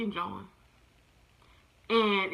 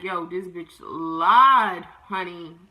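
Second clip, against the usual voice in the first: sassy indignation; 3 semitones lower, 5 dB louder, much slower than usual. Retold or imitated. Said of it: "I'm just like".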